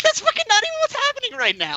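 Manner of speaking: very high-pitched incredulous protest; exasperated squeal. Retold or imitated. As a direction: squeal it out in disbelief, far above your normal pitch. Retold exasperated reply to date.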